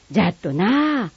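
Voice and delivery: Kagoshima dialect. Acted. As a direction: greet an old friend in broad Kagoshima dialect. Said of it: "鹿児島弁は".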